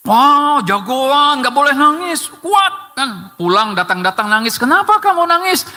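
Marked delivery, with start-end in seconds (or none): 0.1-3.0 high, hearty grandparent voice, slow start; high, slow cheering for a little hero; imitating a grandparent. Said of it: "masih suka begitu nggak, Bu, sama cucunya". 4.6-5.7 much higher, mock-concerned parent; high parent voice asking why the tears; imitating a parent. Parent asks crying child.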